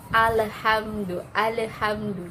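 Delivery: alhamdu with the tongue yanked back, bouncing l; wrong pulled-back l. Shown as a demonstration. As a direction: alhamdu slow, l snapping off. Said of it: "jadi langsung ditarik".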